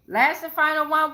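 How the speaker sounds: hyped for the last one; excited. Real, not acted. Announcing the final card.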